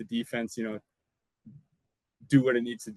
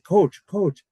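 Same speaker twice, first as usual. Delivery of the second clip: louder, higher call for help; 8 semitones higher, 11 dB louder than usual. Retold or imitated. Stuck on the table, calling to the coach, loud and urgent. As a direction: call out to get his attention.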